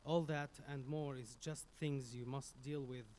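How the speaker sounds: ordinary delivery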